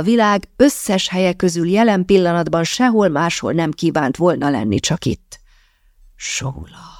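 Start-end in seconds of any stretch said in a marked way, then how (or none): 6.1-6.9 tender, whispered name; soft murmur. A narrator's reading. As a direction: breathe the name out very softly. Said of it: "dünnyögte a férfi lágyan".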